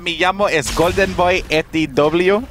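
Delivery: playful mock Spanish accent; put-on Spanish accent. Caster introducing himself in a hammed-up Spanish accent. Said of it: "What's the accent for?".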